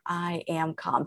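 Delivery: slow, soft mantra; hushed, unhurried self-reassurance; calmest voice. Shown as a demonstration. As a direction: soothe yourself, slowly and softly. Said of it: "speak to yourself in the calmest voice you can muster".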